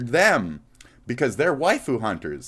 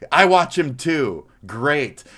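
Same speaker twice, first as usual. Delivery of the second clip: ordinary talk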